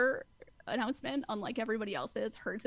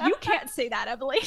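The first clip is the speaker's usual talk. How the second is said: louder imitation of shocked relatives; mock scandalised; 3 semitones higher, 8 dB louder than usual. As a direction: scold in pretend shock. Family reacting to Emily's outrageous remark.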